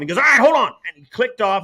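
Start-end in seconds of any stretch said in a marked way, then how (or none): 0.2-0.7 loud, high holler into a headset; imitating a TV boss shouting; imitated director's shout. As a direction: yell it like a harried truck director. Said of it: "he's screaming in the truck".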